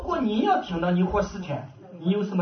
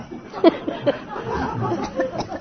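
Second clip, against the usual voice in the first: laugh; 11 semitones higher than usual. Real, not acted. Laughs after the story.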